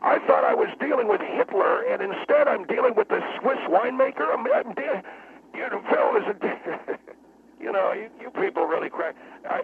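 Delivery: high-pitched, near-apoplectic rant; wound up. Acted. Guest berating host and panel as dumb, wound up. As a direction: rant till the words break up. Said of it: "you're gonna have a stroke".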